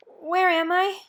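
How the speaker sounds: a dazed woman just waking; weak dazed question. Acted. Annie asks her rescuer where she is, dazed and weak. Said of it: "Then a weak voice spoke".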